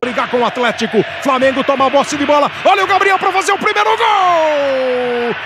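loud, stretched goal cry; screaming goal call. Real, not acted. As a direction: scream it to the stands.

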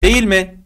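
"değil mi" pitched high, stressed on "değil"; intoned tag question. Shown as a demonstration. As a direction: hit "değil" high and drop on "mi". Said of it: "vurgu ve tonlamayla söylüyorsun". ("değil mi" yüksek perdeden, vurgu ve tonlamayla)

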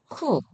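쿠 short and high with a puff; aspirated 쿠. Shown as a demonstration. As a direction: release the k of 쿠 with air. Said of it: "발음하시면 되고요". (거센소리로 짧게 쿠)

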